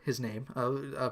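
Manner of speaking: ordinary talk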